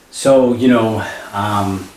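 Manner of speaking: usual speaking voice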